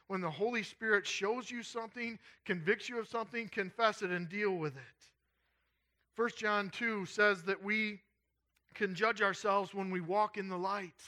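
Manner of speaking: high, emphatic sermon exhortation. Real, not acted.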